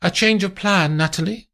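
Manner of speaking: high, brisk; high, businesslike; Amandine. Acted. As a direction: announce it to the sleepy maid. Amandine waking the maid.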